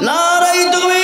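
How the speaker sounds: high, long-drawn chanted slogan; forceful takbir call. Real, not acted. Preacher leading the crowd in takbir, drawn-out rallying chant. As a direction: hold the last vowel out for the crowd to answer. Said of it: "জোর দিয়ে বলি তো বাপ".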